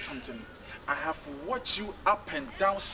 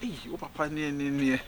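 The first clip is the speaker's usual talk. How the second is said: normal talking voice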